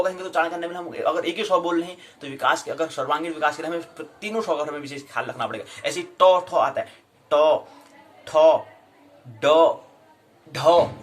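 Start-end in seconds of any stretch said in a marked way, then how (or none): 5.9-10.9 टो, ठो, डो, ढो each said loud and separately, aspiration and voicing clear; contrasting consonants. Shown as a demonstration. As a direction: drill टो ठो डो ढो one by one, pausing between. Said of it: "नहीं आ रहा तो अभ्यास करो". (टो ठो डो ढो का अलग-अलग स्पष्ट उच्चारण)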